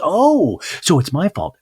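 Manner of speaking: high, loud scoff; hammed-up mother taking offense; mock indignant mom. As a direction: sneer it back defensively.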